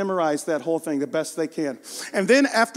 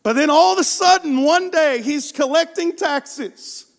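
higher, louder storytelling preacher voice, stretched words; rising preaching climax; 8 semitones higher, 4 dB louder, slower than usual. Acted. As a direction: build the moment toward Jesus' arrival.

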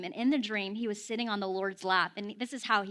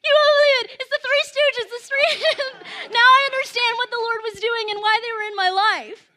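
high, loud shout of revelation; hammed-up, high-pitched excited hollering; imitated yelling; 13 semitones higher, 13 dB louder than usual. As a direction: yell it out like waking from a dream. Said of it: "wakes up yelling from his dream".

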